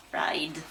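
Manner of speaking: "Fried" low, with the d felt; low "Fried", voiced d at the end. Shown as a demonstration. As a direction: say "Fried" carefully, sounding the final d. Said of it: "Feel the d".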